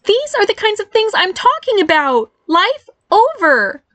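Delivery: very high-pitched, mortified wail; embarrassed cry. Acted. Barry protests to mother over teasing, mortified cry. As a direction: cry out in protest, dragging the last words. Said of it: "Barry cried, covering his face".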